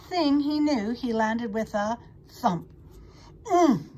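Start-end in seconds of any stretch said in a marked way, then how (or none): 3.5-3.9 short, loud groan of impact. A narrator's reading. Pig lands with a thump, groaning. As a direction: groan high and loud after a bump. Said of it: "he groaned".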